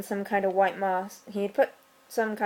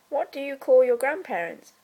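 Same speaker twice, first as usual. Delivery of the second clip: usual speaking voice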